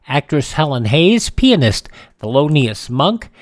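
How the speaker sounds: normal talking voice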